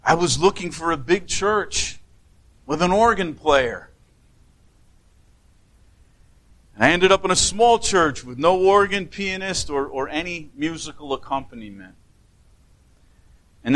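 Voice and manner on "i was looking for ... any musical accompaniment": put-on a light Swiss German accent, pitched higher; a hint of a Swiss German accent, retold fondly. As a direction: gently imitate an old Swiss German lady. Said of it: "with that Swiss German accent".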